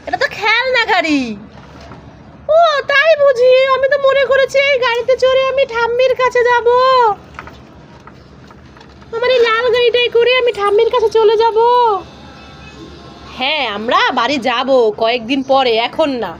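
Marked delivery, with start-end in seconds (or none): 2.5-11.6 put-on high, cheeky little boy voice; child voice. Little son teasing his mother, in a high cheeky child voice. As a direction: play the teasing toddler answering mummy. Voicing son, wants grandma.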